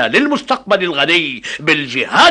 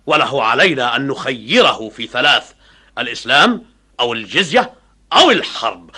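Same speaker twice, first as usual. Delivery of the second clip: normal talking voice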